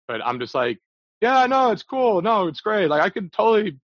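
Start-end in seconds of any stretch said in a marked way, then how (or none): 1.2-3.8 high, loud eager reassurance; overly sweet fake yes; fake agreeable. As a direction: gush agreement you don't mean, pitched way up. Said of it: "saying what's needed at that moment".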